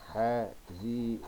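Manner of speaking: "حزينا" slowly split into syllables; very slow, spaced-out "حزينا". Shown as a demonstration. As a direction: dictate "حزينا" piece by piece as you write. Dictates word while writing.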